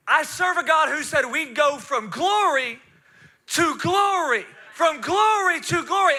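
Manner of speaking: loud, high, emphatic proclamation; full-force preaching. Real, not acted. Preacher proclaiming God's glory to the congregation, forceful. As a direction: build it like a Pentecostal preacher.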